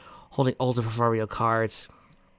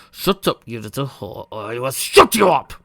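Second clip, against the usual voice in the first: menacing threat; 2 semitones higher, 8 dB louder than usual. Acted. Villain threatening Pinky.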